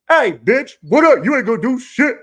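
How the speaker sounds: very high, loud squeaky taunt; taunting cricket. Retold or imitated. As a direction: voice a cocky cricket mocking him.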